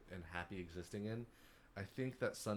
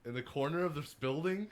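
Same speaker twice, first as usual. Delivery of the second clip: louder, rising disbelieving questions; incredulous retort, played up; mock incredulity; 5 semitones higher, 6 dB louder than usual. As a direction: act baffled by an absurd challenge, pitch climbing at the ends. Retold incredulous reply to a challenger.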